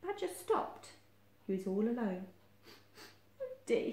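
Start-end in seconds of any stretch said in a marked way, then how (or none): 3.4-3.9 high, small, sorrowful; storybook dejection, pitched up; sniffed sadly. A narrator's reading. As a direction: say it in a tiny, sad voice. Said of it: "he sniffed sadly".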